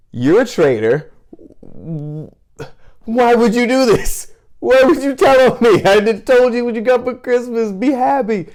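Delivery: furious at sister telling on him; indignant outrage. Retold or imitated. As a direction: yell at the traitor sister. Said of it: "in my mind".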